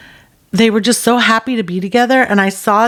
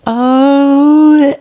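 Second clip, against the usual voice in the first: stunned realization; 2 semitones higher, slower than usual. Retold or imitated. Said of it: "And I was like".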